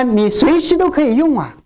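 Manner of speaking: normal talking voice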